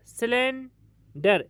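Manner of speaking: Cylinder drawn out, broken before डर; syllable break. Shown as a demonstration. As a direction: hold silane, pause, then say डर in cylinder. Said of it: "हमारी आवाज़ टूट गई". (Cylinder को धीरे, डर से पहले रुककर)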